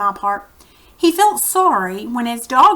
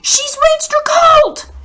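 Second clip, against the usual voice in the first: very high triumphant call; crowing; 6 dB louder than usual. Acted. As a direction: crow it out in triumph. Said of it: "he crowed".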